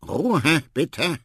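loud, high, cross call. A narrator's reading. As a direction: call out sharply for silence. Marabou calls for quiet. (laut um Ruhe rufen)

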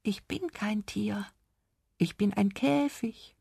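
slow, high, hushed little creature voice; tiny secretive whisper, drawn out. A narrator's reading. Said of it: "und flüsterte".

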